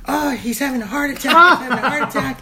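high, panicky retold cry; hammed-up worry; mock alarm. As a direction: imitate someone raising a false alarm. Gracie alarms mom in retold story.

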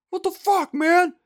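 high, outraged yell. Retold or imitated. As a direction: shout after someone walking off. Guy yells after attacker.

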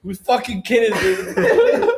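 high, drawn-out demand; mock bus driver. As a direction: mimic the driver, stretching it out. Friend mimics the driver.

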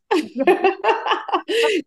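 high, bright laugh at her own story; real burst of laughter. Real, not acted.

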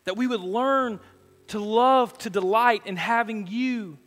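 building from calm to loud, lifted praise; fervent pastoral prayer. Acted. Pastor praying over the congregation, swelling from calm to fervent. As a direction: pour it out to God, rising.

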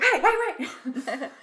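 high-pitched, comic protest; hammed-up panicky objection; mock alarm. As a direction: imitate someone suddenly alarmed, amused. Voices John Smith's alarm at the pounding.

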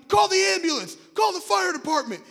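high, loud retold cry for help; urgent shout. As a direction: shout orders to call for help.

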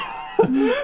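high-pitched burst of laughter; real laugh. Real, not acted. Host laughing at the episode's shambolic sign-off, high giggle.

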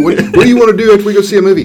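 high, loud, rushed mimicry of a buddy; hammed-up imitation of a pal; mock friend voice. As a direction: parrot your friend's one answer, fast and shrill. Mocking friend's repeated answer.

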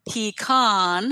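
pecan as PEE-kahn; pronunciation demo. Shown as a demonstration. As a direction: say pecan with EE, then a long AH. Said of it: "come up with the four ways".

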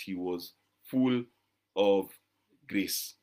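"He was full of grace" with long pauses between words; spaced emphasis. Shown as a demonstration. Preacher stresses Jesus alone was full of grace, slowly. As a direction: drop low and let each word land. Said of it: "The only one who was full of, uh, grace".

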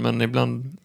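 ordinary talk

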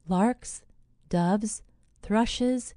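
ordinary delivery

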